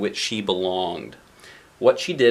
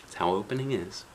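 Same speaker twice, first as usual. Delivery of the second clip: ordinary delivery, nothing marked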